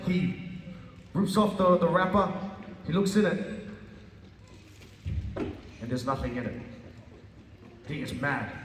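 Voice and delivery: normal talking voice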